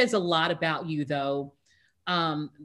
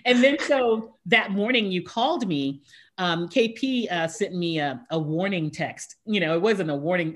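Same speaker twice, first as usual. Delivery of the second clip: ordinary talk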